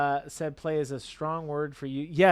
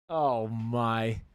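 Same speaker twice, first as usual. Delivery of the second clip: normal talking voice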